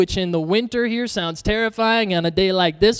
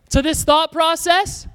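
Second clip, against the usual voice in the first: ordinary talk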